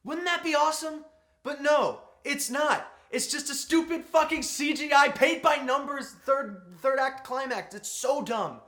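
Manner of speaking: loud, high, fed-up rant about the climax; exasperated rant. Real, not acted. Complaining to viewers about the CGI climax, loud exasperated rant. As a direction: vent at the lazy ending, full force.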